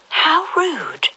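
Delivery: put-on high indignant girl; an offended young girl; Alice voice. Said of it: "thought Alice".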